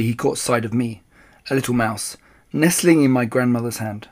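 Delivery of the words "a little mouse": ordinary delivery, nothing marked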